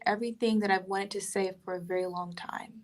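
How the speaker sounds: hushed, breathy confession; a near-whisper, letting it out. Real, not acted. Telling the host what the book let her say, in a whisper.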